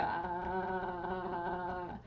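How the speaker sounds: long steady "ah" held low; gentle, understated Tarzan drone while tapping the chest; little Tarzan sound. Shown as a demonstration. Guide shows vibrations from chest tapping, sustained soft Tarzan "ah". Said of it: "make a little Tarzan sound".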